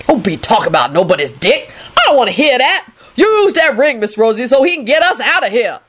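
fed-up scolding that rises in pitch; scold, then boss her around; grumbling. A narrator's reading. Lucretia ordering Rosie to use the ring, grumbling. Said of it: "Lucretia grumbled".

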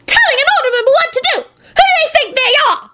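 very high, squeaky-pitched outrage; furious elf. A narrator's reading. Elf in the crowd backing Neville, high and furious. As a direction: rattle off outrage in an extremely high voice. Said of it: "echoed a furious-looking elf".

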